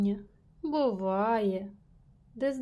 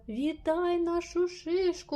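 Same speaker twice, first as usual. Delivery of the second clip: higher, slower call to the departing cone; 6 semitones higher than usual. A narrator's reading. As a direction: call it after the cone, high and drawn out. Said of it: "гукнула Ельвіра".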